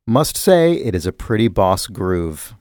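normal talking voice

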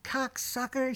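sharp high mock yell of the insult; retold yell. Actress yelling at her co-star, mock yell. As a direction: spit out "Cocksucker!" high, as a yelled insult. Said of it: "She yells at him".

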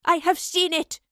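loud, high defiant outburst; defiant roar. Acted. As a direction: roar it back at the Keeper. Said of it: "the professor roared defiantly".

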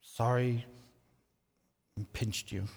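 put-on a shy kid forcing out a sorry; bashful little boy. Young son reluctantly apologising to his sister. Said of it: "Finally, he".

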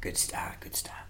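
soft whispered praise; whispering good start during the poem. Acted. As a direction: whisper encouragement. Whispered encouragement.

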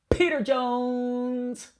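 slow, high drawn-out name call; announcer voice. Acted. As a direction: announce him like a ring MC.

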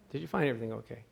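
soft, flat, routine checkout line; tired bored cashier. Retold or imitated. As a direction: mumble it like the thousandth time today. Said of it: "they always say that".

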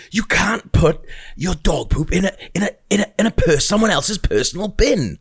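a retold outburst from the angry woman, hammed-up and high; mock raging woman. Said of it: "she's like properly raging".

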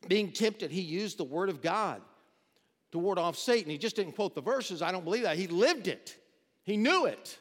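preacher building to a loud peak; preaching crescendo. Acted. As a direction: drive the point home, growing in force.

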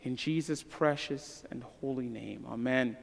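soft, low, fading prayer voice; closing prayer. Acted. Pastor praying for his people at the end of the sermon, hushed. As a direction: pray quietly over the congregation.

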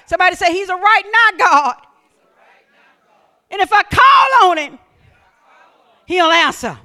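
loud, rising whoop of a preacher in full flight; preaching at full force. Acted. Pastor whipping up the church with faith, full-force preaching. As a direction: preach it hard to the congregation.

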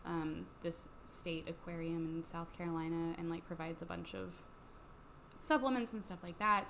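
ordinary talk, nothing marked

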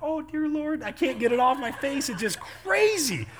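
high, hammed-up frantic wail; comic retold freak-out; mock panic. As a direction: play desperate, stretching the last words. Retells panic over mascara on face.

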